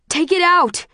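high, loud desperate yell. A narrator's reading. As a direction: yell in agony, begging for relief. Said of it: "he yells".

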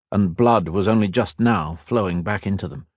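ordinary delivery

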